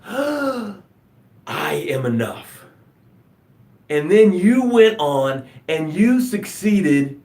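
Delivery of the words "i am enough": soft and slow, much softer; newborn's first words. Pretend. A newborn speaking its first breath, soft and slow. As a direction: breathe it out gently, slowly. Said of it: "your first breath was".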